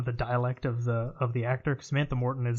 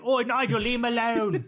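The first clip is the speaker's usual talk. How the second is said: hammed-up posh British accent, much higher and laughing; mock British accent; 10 semitones higher, 3 dB louder than usual. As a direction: play a terrible fake English lady. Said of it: "And it's terrible. It sounds awful".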